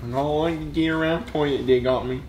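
Jeffy voice. Acted. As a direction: drag it out like a dopey kid. Repeating line as Jeffy.

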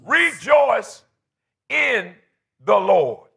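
REJOICE loud and high, each phrase set apart; emphatic. Real, not acted. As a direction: pause hard after Rejoice and after in. Repeats the reader's verse for emphasis.

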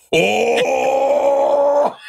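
long, high imitation of a hyped onlooker; slam dunk crowd whoop. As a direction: holler "oh" like you just saw a huge dunk. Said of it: "it was like a slam dunk contest".

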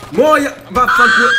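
loud, slow furious swearing; real slow rage; angry curse. Real, not acted. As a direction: spit the curse at the enemy. Curses the enemy hag.

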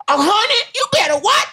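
very high squealing sass; a shrill mock diva bit; hacky sassy voice. Shown as a demonstration.

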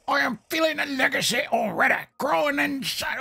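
high, overwrought dying-victim voice; terrible hammy acting. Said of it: "that was terrible acting".

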